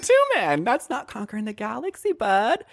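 high, pointed repeat of two men; a touch of sing-song teasing; mock correction. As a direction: correct him with a smirk. Correcting count in character.

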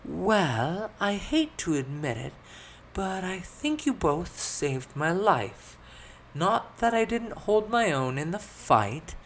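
put-on high, slow, drawn-out girl's voice; grudging admission in a high voice; reluctant young noblewoman. A narrator's reading. Elizabeth grudgingly crediting her companions, reluctant high voice. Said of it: "spoke up in a relatively quiet tone".

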